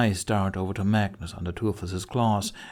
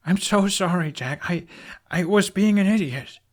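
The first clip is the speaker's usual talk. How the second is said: tearful apology; 10 semitones higher, 5 dB louder than usual. A narrator's reading. Hiccup tearful apology after sniffing.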